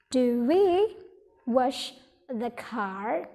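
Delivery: wash the car drawn out with a rise; slow teacher demo of a question; very slow model question. Shown as a demonstration. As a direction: stretch each word slowly for students.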